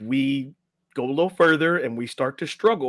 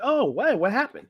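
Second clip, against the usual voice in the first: much higher, airy mock bystander voice; casual bystander; 9 semitones higher than usual. Onlooker asks the swimmer what happened, mock innocent. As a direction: play the clueless onlooker, lightly mocking. Bystander asking after the rescue.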